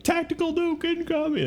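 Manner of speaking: very high, held monotone announcement; high-pitched mock warning call; game-style alert. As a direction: imitate a video edit's alert voice. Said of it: "weird edits where he's like".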